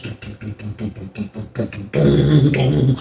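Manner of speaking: thump out steps climbing, quietly; soft vocalized stomping; imitated footsteps. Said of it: "go up the steps like bad dudes".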